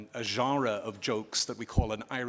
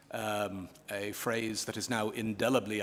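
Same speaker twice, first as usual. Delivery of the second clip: normal talking voice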